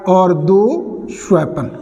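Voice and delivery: दुष्वपन drawn out slowly, every syllable separate; teacher dictating दुष्वपन slowly for the class; slow dictation. Shown as a demonstration. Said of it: "तो ये विसर्ग भी किस में बदल जाएगा?".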